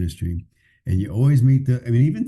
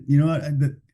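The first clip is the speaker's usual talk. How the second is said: normal talking voice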